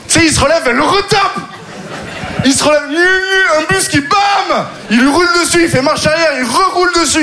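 high, gleeful shouting of a cartoon revenge; yelling out each new blow with glee; gleeful revenge fantasy. Comic revenge fantasy told to the audience, shouted high with glee.

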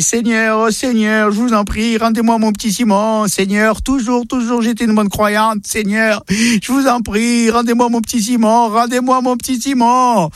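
high, pleading prayer, slowing on the repeats; desperate praying grandmother. Acted. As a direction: beg the Lord like a frantic grandma. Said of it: "elle commence à prier".

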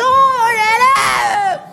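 high, slow, wailing astonishment; long marvelling wail; amazed exclamation. Retold or imitated. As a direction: stretch it out high in hammed-up amazement. Amazed at meeting the president.

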